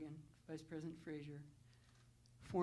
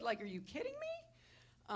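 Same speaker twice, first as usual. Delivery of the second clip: disbelief; 10 semitones higher than usual. Real, not acted. Disbelief at her own luck.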